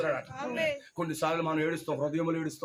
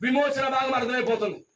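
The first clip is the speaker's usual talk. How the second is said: raised, declaiming voice; preacher's proclamation; 6 semitones higher than usual. Real, not acted. Preacher announcing the redemption to congregation, proclaiming. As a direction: lift it up and proclaim it.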